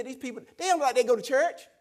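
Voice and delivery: loud, high incredulous protest; retold upset complaint. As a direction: grumble loudly about other people. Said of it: "I get upset with him".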